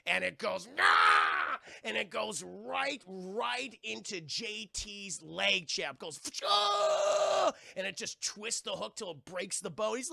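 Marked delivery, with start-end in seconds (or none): 0.8-1.6 high, loud attack yell; sudden high screech of a striking monster; imitated lunging shriek; mock attack shriek. 6.5-7.5 long, high, loud mock scream; hammed-up impact scream; imitated stabbing yell. Imitating the hook going into leg.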